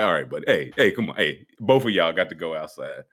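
low-voiced mock security guard. Acting out security ejecting guests.

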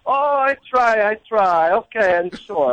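high, slow mock-modest celebrity reply; Wolfgang Puck. Celebrity chef brushing off a fan's praise, mock modest. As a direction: lightly caricature a chef accepting praise, starting high. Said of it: "And he goes".

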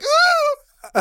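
a squeaky high "ooh", caught out; nervous high ooh. Retold or imitated. Caught using the phone, a high nervous ooh. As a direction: squeak it out high like a kid in trouble.

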